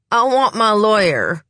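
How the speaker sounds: high, loud huffy demand through tears; huffing demand. Acted. Suspect weeping and demanding a lawyer, loud and high. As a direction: huff it out, tearful and defiant. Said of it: "Salt huffs".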